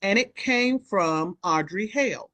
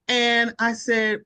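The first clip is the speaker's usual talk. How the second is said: ordinary delivery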